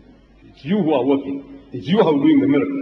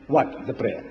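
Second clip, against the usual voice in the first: normal talking voice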